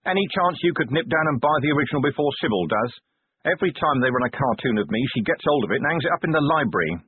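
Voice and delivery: quick, pleading commander voice; Vimes, hurried commander. Acted. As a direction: rush the request, fast.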